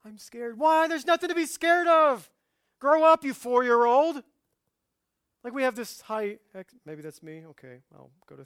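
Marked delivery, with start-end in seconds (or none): 0.0-0.6 put-on higher-pitched child voice; mock little-kid fear; scared child. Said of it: "As we often do sometimes to our children". 0.6-4.2 very loud, high mock shout; hammed-up angry parental yell. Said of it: "As we often do sometimes to our children".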